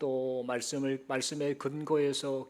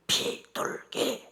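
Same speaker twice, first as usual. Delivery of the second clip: slow, drawn-out whisper; covered-mouth whisper; much slower than usual. Acted. Grandmother hiding her mouth while hinting to the clerk, slow whisper. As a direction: whisper each syllable slowly behind your hand. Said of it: "입을 싹 가리면서요".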